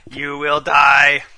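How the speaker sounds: very slow menacing robot; a threatening machine, word by word. Pretend. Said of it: "Vista, what are you doing?".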